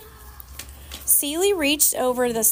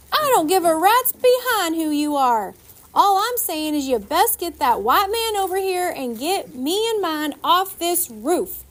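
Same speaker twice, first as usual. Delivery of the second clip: higher, louder, squeaky rant building; louder and squeakier; 7 semitones higher, 4 dB louder than usual. A narrator's reading. Woman on the roof demanding rescue, louder and squeakier. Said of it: "got louder and squeakier".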